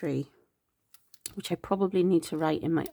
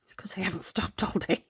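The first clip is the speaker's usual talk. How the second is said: tired whisper; faster than usual. Real, not acted. Tired aside after yawning.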